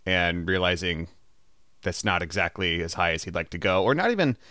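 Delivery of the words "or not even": usual speaking voice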